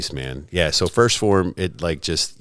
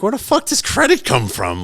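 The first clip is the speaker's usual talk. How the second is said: much higher, baffled outburst; incredulous; 13 semitones higher, 4 dB louder than usual. Retold or imitated. Questioning his buddy's sudden interest, incredulous. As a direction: replay total bewilderment at a friend. Retold disbelief at friend's announcement.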